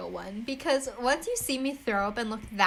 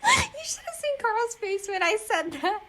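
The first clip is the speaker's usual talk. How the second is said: high, squeezed through laughter; gleeful words tumbling out of a laugh; 10 semitones higher than usual. Real, not acted. Host teasing co-host about being replaced, laughing.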